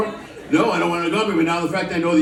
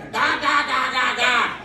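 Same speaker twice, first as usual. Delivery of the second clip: mock stoner giggle; 6 semitones higher, slower than usual. Mocking audience member laugh.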